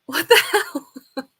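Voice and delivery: slow, drawn-out laugh through the words; laughing disbelief. Real, not acted. Reacting to an odd quiz answer.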